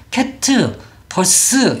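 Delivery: "캣, 버스" as 캐-트, 버-쓰; Korean-style two syllables. Shown as a demonstration. Teacher rejecting a mistake, "캣, 버스" in two syllables each. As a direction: voice a full final vowel in "캣, 버스". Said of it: "이렇게 소리를 낼 수 없어요".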